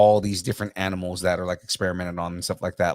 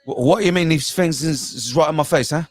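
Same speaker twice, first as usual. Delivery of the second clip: higher, indignant Cockney demo; Cockney accent; 6 semitones higher, 3 dB louder than usual. Shown as a demonstration. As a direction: protest in punchy Cockney. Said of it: "that's a Cockney accent".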